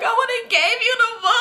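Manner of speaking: very high, wailing sob voice; hammed-up tearful shriek of regret; mock screaming cry. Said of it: "And I'm screaming".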